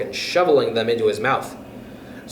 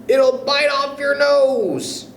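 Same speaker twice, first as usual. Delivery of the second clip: Mr Twit scaring her; 12 semitones higher, slower than usual. Acted. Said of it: "said Mister Twit".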